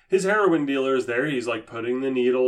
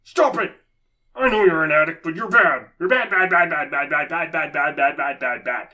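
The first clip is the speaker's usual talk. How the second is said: mock scolding pastor; 3 semitones higher, 3 dB louder than usual. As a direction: nag him like a scolding preacher. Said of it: "he keeps looking at the addict going".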